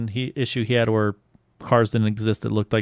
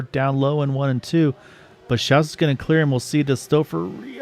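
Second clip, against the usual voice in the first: fast, lively race call; play-by-play; 3 semitones higher than usual. Acted.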